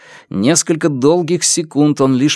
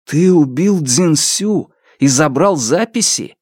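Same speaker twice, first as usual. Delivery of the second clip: slow, stunned question, pitched higher; disbelieving; 5 semitones higher than usual. A narrator's reading. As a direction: ask it slowly, unable to believe it. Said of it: "Фан Му не верил собственным ушам". (медленно, не веря собственным ушам)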